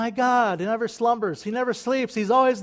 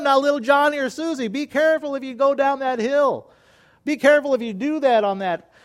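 higher, louder warning parent voice; cautioning parent; 6 semitones higher, 5 dB louder than usual. Retold or imitated. Parent warning child on the hill, higher and sing-song. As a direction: warn the little ones sing-song. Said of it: "You might have told them".

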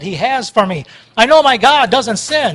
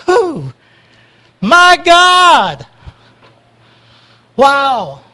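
joyful praise; 5 semitones higher, 6 dB louder, much slower than usual. Real, not acted. Praise outburst.

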